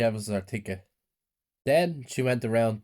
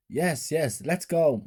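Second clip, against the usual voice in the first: high, eager, mildly raised cheer; children shouting; 6 semitones higher, much slower than usual. A narrator's reading. Children cheer to enter the carnival, mild shout. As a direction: urge them on like excited children. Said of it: "my brothers and sisters shouted".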